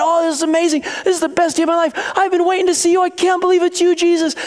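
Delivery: put-on high-pitched, breathless child's delight; gushing excited kid. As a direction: gush on and on like a thrilled kid. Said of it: "he's going on and on".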